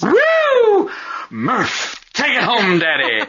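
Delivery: loud high whooping cheer. Pretend.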